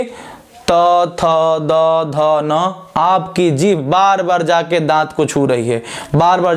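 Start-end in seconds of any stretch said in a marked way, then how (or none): 0.0-0.7 त said softly, unvoiced; demonstrating a sound. Shown as a demonstration. Teacher showing how त touches the teeth. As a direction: breathe out त with the tongue on the teeth. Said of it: "आप बोल के देखिए".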